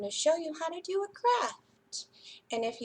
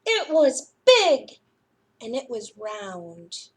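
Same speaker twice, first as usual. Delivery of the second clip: lively, expressive storytime read-aloud; reading to children; 6 dB louder than usual. A narrator's reading. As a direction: read it like a librarian to little kids. Reads picture book to children.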